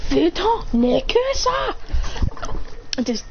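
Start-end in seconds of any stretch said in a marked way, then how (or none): 0.1-1.8 much higher, slow and snide; dismissive jibe. Acted. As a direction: brush him off with contempt. Pushing away hands.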